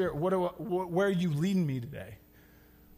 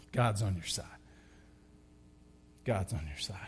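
much lower and softer, tender; almost a hush, reassuring; soft reassurance; 10 semitones lower, 9 dB quieter than usual. Acted. Pastor to listeners, softly reassuring.

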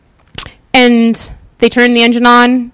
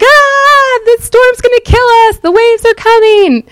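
panicked disciples; 13 semitones higher than usual. Retold or imitated. Frightened disciples waking Jesus in the storm, mock panic. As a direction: shriek it like the boat's about to sink. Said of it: "the disciples are like me when I'm freaking out".

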